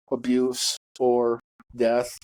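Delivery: usual speaking voice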